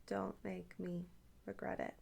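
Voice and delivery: ordinary talk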